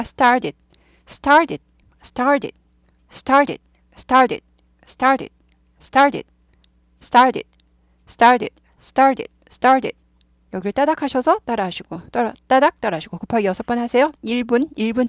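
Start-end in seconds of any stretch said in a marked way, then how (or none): 0.0-10.0 "started" with a curled R flicking into a d; curled R, soft t. Shown as a demonstration. Teacher models for repeat-after-me, curled-R "started". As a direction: drill "started" in staccato bursts, R curled, t as d. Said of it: "R 꼬부리다가".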